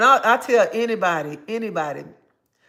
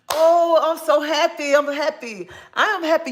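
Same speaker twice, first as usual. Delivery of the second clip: high, loud burst of joy; excited; 9 semitones higher, 6 dB louder than usual. Real, not acted. Delighted for a viewer seeing her grandkids, high and loud.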